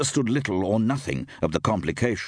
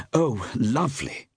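higher-pitched delighted wife; enthusing; 5 semitones higher than usual. A narrator's reading. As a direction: gush with light enthusiasm. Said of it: "enthused Steve".

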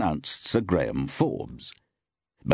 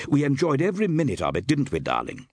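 quick, high, happy gush; enthusing; 7 semitones higher, faster than usual. A narrator's reading. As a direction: gush about it brightly and quickly. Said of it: "enthused Steve".

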